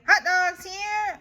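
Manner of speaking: high, loud copycat yell; Eloise yelling. Acted. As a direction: shout it back like the vendor. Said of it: "I yell".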